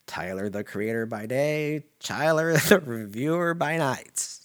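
slowing, rising movie-trailer line with a laugh; mock trailer tagline. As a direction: sell it like a film tagline. Dramatic movie-trailer style tagline.

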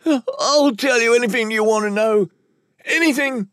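high, terrified whimper. Acted. Nicked captive promising to tell all, whimpering. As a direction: plead desperately in a high whimper. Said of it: "as he whimpered".

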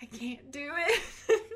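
slow, high wail edged with laughter; mock whine. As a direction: whine it helplessly, half laughing. Retold wail of reluctance to sister.

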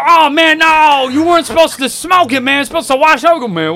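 very high, loud scolding; outraged brother. Retold or imitated. Brother scolding me for smoking it, very high and loud. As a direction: squeal the complaint way up high and loud. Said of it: "he go".

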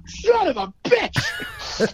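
high, slow, stretched-out curse of disbelief; shocked outburst. Retold or imitated. To the bartender who says the bottle is gone, a high stretched curse. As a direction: drag out the curse in mock outrage. Bar out of the bourbon.

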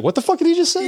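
Boricua sister flaring up; furious sister. Retold or imitated. Sister snaps at the boss on the phone, outraged high yell. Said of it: "that Boricua in her".

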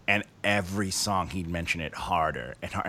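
ordinary talk, nothing marked

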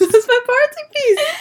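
high laughing reply. Real, not acted. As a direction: say it while laughing. Laughing after the seal noise.

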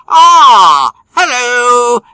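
a posh English "hello" greeting; English accent. Acted. Host greets co-host in a hammed-up English accent. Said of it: "Don't know why the English accent".

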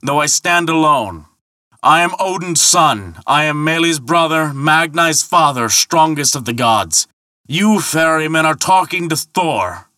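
high, proud shouted boast; shouting. Acted. Thor naming himself to the ferryman, shouting proudly. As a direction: proclaim your lineage across the channel. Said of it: "shouted Thor".

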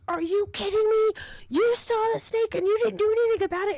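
high, loud, fast accusation; outraged disbelief. Retold or imitated. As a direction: lay into a husband in retold outrage. Acted outrage at husband.

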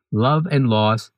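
normal talking voice

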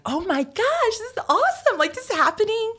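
retelling her excited reaction; thrilled gush. Retold or imitated. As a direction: squeal over the big news. Retold thrill at the invite.

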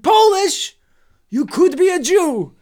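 put-on high, loud old man voice. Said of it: "young man with an old man's voice".

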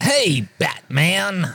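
cartoony Joker. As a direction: tease the hero like a cartoon. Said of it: "that's so cartoony".

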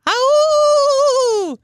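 very high, loud coyote howl. Retold or imitated. Imitating a coyote.

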